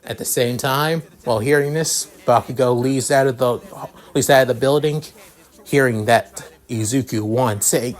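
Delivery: ordinary delivery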